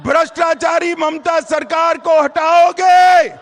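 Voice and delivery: shouted rally question. Real, not acted. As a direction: belt it out to the crowd. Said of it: "जोर से बोलो अंत तक".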